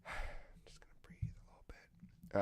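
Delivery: soft unvoiced whisper, just breathing out; whispered relief. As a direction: whisper it like finally resting. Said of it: "I'm like".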